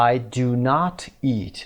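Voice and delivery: slow I do not eat, not stressed. Shown as a demonstration. Teacher spelling out the negative, not stressed. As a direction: lean on not, slowly. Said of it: "not رو باید بعدش بیاریم".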